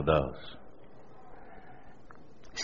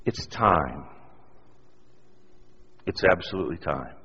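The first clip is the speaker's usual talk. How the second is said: hushed altar call; 4 semitones lower, 10 dB quieter than usual. Real, not acted. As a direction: gently invite them to be released. Quiet altar call appeal.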